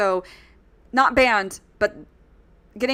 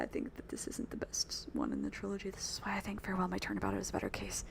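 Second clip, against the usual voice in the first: whispered aside; 2 semitones lower, 15 dB quieter than usual. Real, not acted. Aside to chat, dropped voice.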